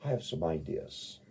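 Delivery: ordinary talk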